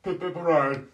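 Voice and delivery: loud, high British cheer; mock British accent. Prankster mocking British strangers, loud mock British cheer. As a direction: whoop it in a hammed-up British accent.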